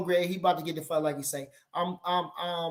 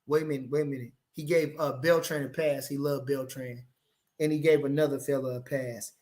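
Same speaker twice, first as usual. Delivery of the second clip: ordinary talk, nothing marked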